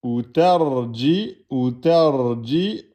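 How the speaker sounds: "ou terji" stretched out slowly, rising at the end; teacher blending syllables. Shown as a demonstration. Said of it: "Et ensuite, on a quoi?".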